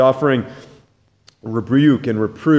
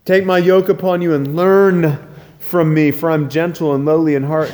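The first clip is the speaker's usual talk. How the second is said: fervent preaching; 7 semitones higher, 7 dB louder than usual. Acted. Preaching Jesus' invitation loudly.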